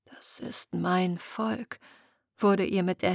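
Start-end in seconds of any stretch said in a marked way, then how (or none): 0.1-1.8 slow, softer, awed; hushed realisation. Acted. Muriel realises the selkies are her people, hushed and slow. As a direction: let the truth sink in quietly.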